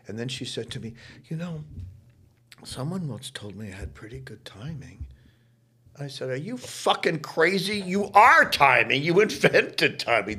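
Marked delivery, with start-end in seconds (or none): 1.2-5.1 modest offhand whisper; bashful whisper to a young castmate. Retold or imitated. Star modestly mentioning her timing. 6.3-10.4 high, loud disbelieving outburst; shouting at the star that she invented timing; incredulous shout. Retold or imitated. Young actor exclaiming at her modesty.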